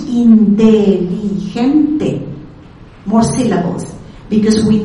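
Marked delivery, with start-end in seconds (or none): 0.1-2.2 slow "inteligente", syllable by syllable; Spanish pronunciation. Shown as a demonstration. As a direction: stretch "inteligente" so every vowel rings. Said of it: "In Spanish".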